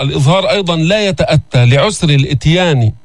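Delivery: normal talking voice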